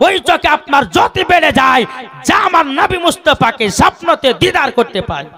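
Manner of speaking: quick preaching climbing to a high pitch; fervent preaching. Real, not acted. Preaching builds in fervour. (জোরালো ওয়াজ, ক্রমে চড়া সুরে)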